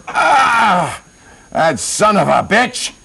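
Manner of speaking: high yell into drawn-out cursing; hammed-up hunter's fury; furious outburst. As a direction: rage at the bear that humiliated you. Acted furious hunter after humiliation.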